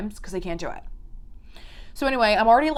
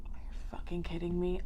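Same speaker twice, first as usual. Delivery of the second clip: voiceless whisper of disbelief; stunned whisper; 3 semitones lower, 12 dB quieter than usual. Real, not acted. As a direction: whisper it as if too shocked to speak. Stunned reaction to the statue.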